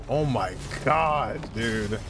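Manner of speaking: ordinary talk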